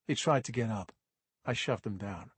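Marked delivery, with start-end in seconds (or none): none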